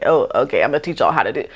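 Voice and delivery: mock the awkward vlogger who avoids the camera; awkward stiff delivery. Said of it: "don't be like this".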